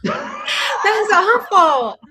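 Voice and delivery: drawn-out laughing cry. Real, not acted. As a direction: laugh it out, high and loud. Laughing at the twist.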